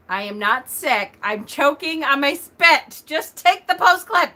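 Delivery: from firm to loud, high insistence; building insistence. Retold or imitated. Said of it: "I said".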